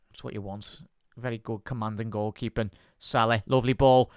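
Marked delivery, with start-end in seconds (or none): none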